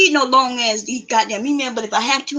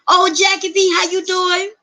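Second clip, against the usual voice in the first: higher, louder, bright hello; cheery greeting; 7 semitones higher, 3 dB louder than usual. Pretend. Answering a viewer who emails her, with a high cheerful hello. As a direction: greet her like a happy surprise.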